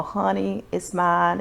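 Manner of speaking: normal talking voice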